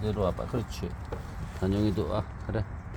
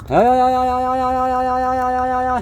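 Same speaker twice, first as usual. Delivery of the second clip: calling out; 11 semitones higher, 4 dB louder than usual. Real, not acted. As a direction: holler to get the dogs running. Calling dogs, drawn-out cry.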